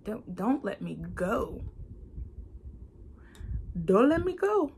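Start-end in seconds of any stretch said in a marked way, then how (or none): 3.9-4.7 cried out much higher and louder; pleading prayer cry, high and loud; desperate plea. Retold or imitated. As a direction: beg God not to let go. Quoting her prayer to God.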